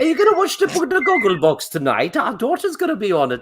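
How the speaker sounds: proud parent tells neighbours, high mock Welsh accent. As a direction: gush like a proud Welsh mum. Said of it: "What accent is that? / That's Welsh still".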